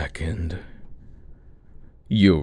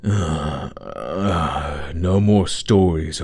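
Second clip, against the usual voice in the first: usual speaking voice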